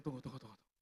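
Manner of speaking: low, soft repeated words; hushed retold stalling. Husband stalls his wife while he listens, hushed. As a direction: hold someone off under your breath.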